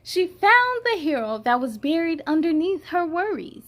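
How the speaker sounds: put-on bright read-aloud for children; storybook reading. A narrator's reading. Storyteller reading to young viewers, bright read-aloud. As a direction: read it to the kids with lift. Reads picture book aloud.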